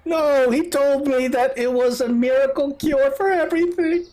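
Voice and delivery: high-pitched tearful protest, acted; high, hammed-up wail; wailing mother. Mother protests under questioning.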